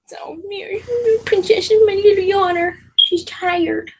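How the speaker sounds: high-pitched pet talk; cooing to puppy. Real, not acted. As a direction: coo sweetly to a sleepy puppy.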